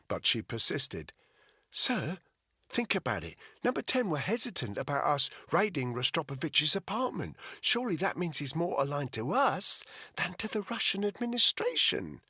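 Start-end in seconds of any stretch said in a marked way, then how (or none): 1.7-12.2 put-on a touch higher, earnest young officer; lightly voiced Alice. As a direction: gently reason with her boss.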